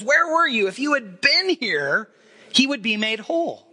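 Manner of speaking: pleading Martha. Retold or imitated. Martha reproaching Jesus.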